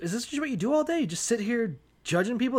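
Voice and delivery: high-pitched, puzzled challenge; incredulous questioning. Retold or imitated. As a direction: question him like you can't believe it. Jethro questions Moses in disbelief.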